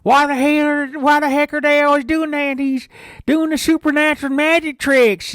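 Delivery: high, loud hollered complaint; loud Idaho camper. Retold or imitated. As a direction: holler it so the whole campground hears. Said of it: "just go up to everybody and start".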